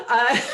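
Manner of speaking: loud high burst of laughter. Real, not acted. Wife laughing at her own Jamaican accent, real high laugh.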